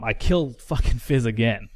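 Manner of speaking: usual speaking voice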